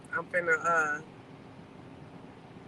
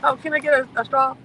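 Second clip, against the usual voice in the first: loud, high-pitched polite request; calling to server; 5 semitones higher, 9 dB louder than usual. Real, not acted. Customer asks drive-thru worker for a straw, calling out. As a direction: project it out the car window, higher.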